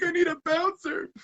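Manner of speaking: high, loud comic cry for help; exaggerated high-pitched distress call; mock panicked plea. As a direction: squeal for rescue, hammed-up. Retells his plea for rescue.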